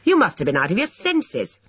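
high, emphatic reproach. A narrator's reading. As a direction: pitch it up and accuse. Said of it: "Alfred's higher-pitched voice said".

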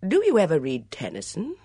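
airily changing the subject; conversational. A narrator's reading. Suitor brings up poetry with Philippa, conversationally. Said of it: "inquired Edmund conversationally".

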